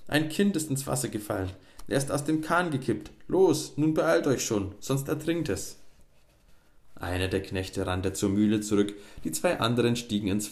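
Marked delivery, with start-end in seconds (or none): 0.1-5.8 urgent miller. A narrator's reading. Miller urges farmhands in panic.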